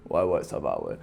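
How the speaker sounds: deep mumbled answer; low cool reply. Retold or imitated. His own low reply.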